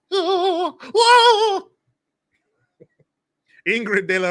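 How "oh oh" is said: high mock sobbing oh, rising loud; mock wail. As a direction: ham up a fake wail. Mock crying after imitation.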